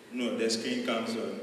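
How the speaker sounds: ordinary talk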